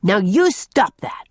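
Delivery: put-on slow, firm child's order; stern young boy laying down the law; commanding. A narrator's reading. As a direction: boss the other kid into stopping. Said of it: "commanded Jerry".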